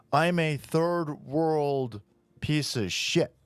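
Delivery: very slow put-on voice; slow mock voice. Cyclist mocked as refusing to move, slow put-on. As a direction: drag it out slowly in a mock migrant voice. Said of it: "the guy's like".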